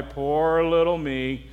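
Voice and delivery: low, slow mock self-pity; Eeyore. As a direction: mope slowly like a gloomy donkey. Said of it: "in Eeyore's voice".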